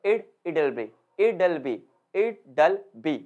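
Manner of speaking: "it'll be" with IT and BE stressed, will swallowed; slow, repeated "it'll be", will reduced to a weak 'll. Shown as a demonstration. Teacher drilling weak will, IT and BE stressed in "it'll be". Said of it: "विल पे बिल्कुल भी stress नहीं".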